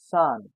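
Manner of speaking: Zahn said "tsahn"; Z of Zahn sounded like s; German pronunciation. Shown as a demonstration. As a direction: start Zahn with a hissed s sound. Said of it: "You have to notice the difference".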